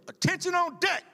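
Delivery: high barked class-leader call of attention; retold yell. Class leader alerting the barracks, high retold yell.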